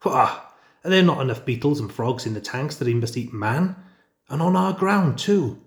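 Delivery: high, loud indignant outburst; outraged. Acted. Father Wolf outraged at man-eating on his ground, high and loud. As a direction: bristle with outrage.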